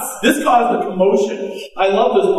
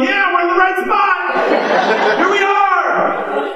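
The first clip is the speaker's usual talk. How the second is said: high, laughing call, slowing on here we are; the big guy hollering down through the roof; a gleeful high shout from above; excited shout; 12 semitones higher, slower than usual. Retold or imitated. Big dude peering through the hole.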